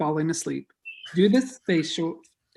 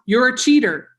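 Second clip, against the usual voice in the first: loud, higher-pitched accusing shout; yell; 5 semitones higher, 8 dB louder than usual. Acted. A voice breaking out in a saloon fight, yelled. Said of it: "A voice yells".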